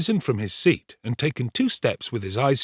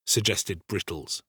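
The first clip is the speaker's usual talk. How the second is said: ordinary delivery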